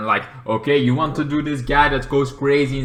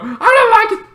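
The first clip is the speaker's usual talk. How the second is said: mock whine; 7 dB louder than usual. Whining complaint.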